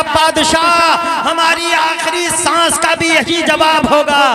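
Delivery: slow, stretched call to the king; drawn-out address. Acted. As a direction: draw out the word Badshah slowly.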